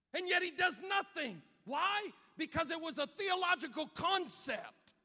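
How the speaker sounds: loud, high, impassioned preaching shout; full-force preaching. Acted. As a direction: climb to a roaring pulpit peak.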